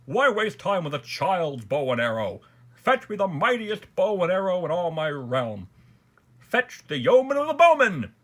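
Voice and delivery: loud, high, imperious order building to a shout; ringing royal command. A narrator's reading. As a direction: command the court, rising to a full call. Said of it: "The king's voice rang out through all the palace".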